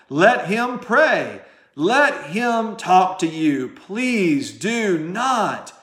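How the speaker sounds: slow, drawn-out preaching cadence; emphatic preaching. Real, not acted. Preacher urging listeners to be honest with their pastor, slow and emphatic. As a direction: stretch each phrase out with rising and falling weight.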